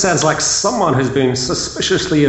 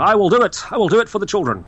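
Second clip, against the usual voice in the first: mock heroic vow; 7 semitones higher, faster than usual.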